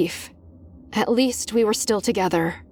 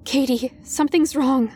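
usual speaking voice

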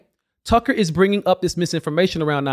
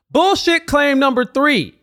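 slow, higher, punchy title read; slow emphatic announcement; 8 semitones higher than usual. Acted. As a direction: announce it like a headline. Title card for each claim.

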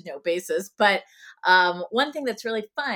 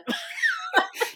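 embarrassed laugh. Real, not acted. Embarrassed at being asked to sing.

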